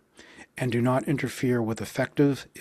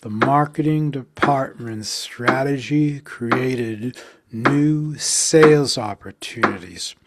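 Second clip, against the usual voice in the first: slow, beats on marketing and sales, louder on sales; slow counted stress; 3 dB louder, slower than usual. Shown as a demonstration. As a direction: pulse the stressed syllables evenly. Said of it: "practice something like this very slowly".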